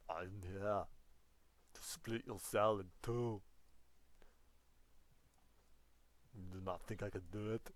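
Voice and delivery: put-on lightly accented, punchy Schwarzenegger; Arnold Schwarzenegger. Teacher as Arnold threatens to split the cell, lightly accented. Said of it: "I'm trying to do an Arnold Schwarzenegger impression".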